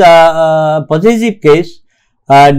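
ordinary talk

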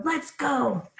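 burglar. Acted. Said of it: "said the second burglar".

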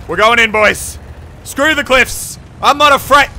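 loud, high reckless yell; daring shout. Real, not acted. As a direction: charge in fearless. Charging down the cliff.

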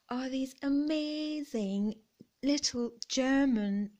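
ordinary delivery

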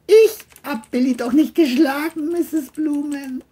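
high put-on voice, innocent; John denying. John denies to the mother that he hit Billy, high and innocent. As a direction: play innocent.

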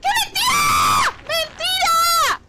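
high, loud and very slow drawn-out protest; outraged cry. Real, not acted. Player protesting an unfair hit, stretched loud cry. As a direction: wail it out in indignation.

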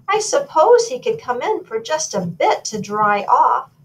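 a hint of a reluctant royal softening; relenting royal. A narrator's reading. Royal parent wonders about sending the goat away, lightly voiced second thoughts.

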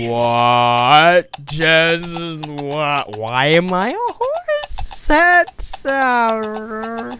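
rising, drawn-out incredulous wail; baffled disbelief. Real, not acted. Player discovering they are a horse, high-pitched disbelief. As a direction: stretch the words and climb high in bewilderment.

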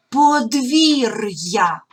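подвір'я said very slowly with a clear break before я; separated pronunciation. Shown as a demonstration. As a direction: stretch подвір'я, keeping р hard and then a separate й-а. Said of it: "роздільна вимова р".

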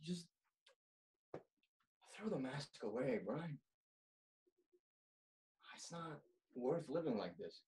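low, soft, imploring; softly begging a friend to stop pretending; quiet plea. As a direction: let it drop to a hushed, sad appeal. Final plea to drop the mask.

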